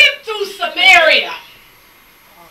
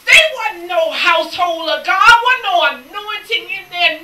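ordinary talk, nothing marked

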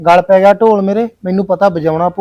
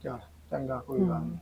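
much softer, breathed out, giving up; resigned whisper; 8 semitones lower, 20 dB quieter than usual. Acted. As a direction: let it die on your breath. Groom gives up, resigned.

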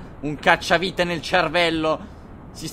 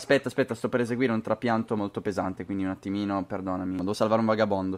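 softer, murmuring to mom while busy; hushed phone aside; 9 semitones lower, 8 dB quieter than usual. Real, not acted. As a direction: keep it low, half-distracted on the phone. On phone with mom mid-game. (a bassa voce al telefono con la mamma, distratto)

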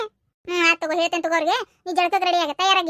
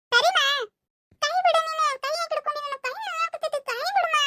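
put-on high, whining little girl begging; a small girl pleading to be let go; little girl whimpering at her mother; whiny child; 7 semitones higher, faster than usual. Child begs mother release hand.

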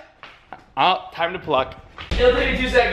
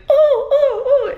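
high, louder mocking squeals; mock-fanboy oh's, pitched way up; 5 dB louder, much slower than usual. Kid in the corner making fun of him, high mocking squeal. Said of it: "kinda making fun".

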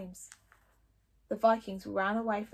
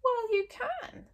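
stoutly; 13 semitones higher, slower than usual. Acted. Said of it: "said Fishlegs stoutly".

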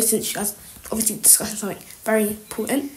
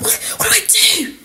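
high-pitched stammered panic; panicked; 10 semitones higher than usual. Acted. Trapped snooper to themselves, high panicked fretting. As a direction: repeat yourself helplessly, pitch shooting up.

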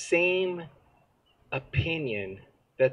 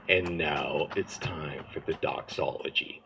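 Jack Retzer's voice; 9 semitones lower, 6 dB quieter than usual. Retold or imitated. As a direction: sink the voice down and drag each word solemnly. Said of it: "same exact way".

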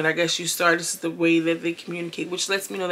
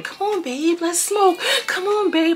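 put-on much higher, sweet coaxing girlfriend voice; 11 semitones higher, slower than usual. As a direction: coax him in a high voice. Girlfriend coaxing him to smoke.

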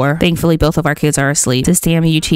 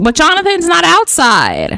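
baffled protest; 10 semitones higher, 3 dB louder than usual. Real, not acted. Baffled at toddler being cold.

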